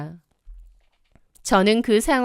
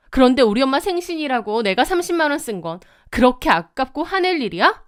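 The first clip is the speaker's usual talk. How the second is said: fast, higher, heated demand at the husband; angry confrontation; 4 semitones higher, faster than usual. Acted. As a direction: fire the accusation at him fast and rising. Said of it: "화가 나서 따졌죠".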